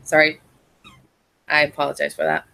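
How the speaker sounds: usual speaking voice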